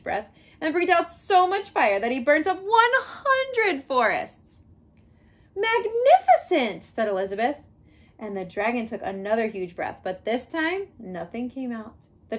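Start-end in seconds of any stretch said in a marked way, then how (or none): 5.6-6.8 high, slow gushing praise; mock amazement. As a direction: lay the flattery on thick. Elizabeth flatters the dragon.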